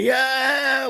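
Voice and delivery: long, high drawn-out "yeah"; excited cheer. Retold or imitated. Friend answering the door after the win, excited cheer.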